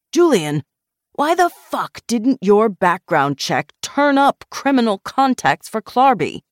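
high, loud accusing question; angry demand. A narrator's reading. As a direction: demand an answer from a subordinate. Boss angrily challenges background check.

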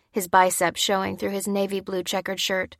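plain reading voice

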